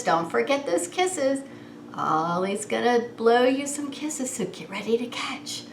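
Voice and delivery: ordinary delivery, nothing marked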